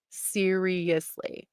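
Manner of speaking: "Seriously" drawn out very slowly, every syllable weighed; slow emphatic. Real, not acted. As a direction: stretch "Seriously" slowly in disbelief. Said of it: "Like, seriously".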